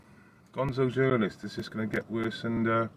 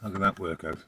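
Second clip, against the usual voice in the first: usual speaking voice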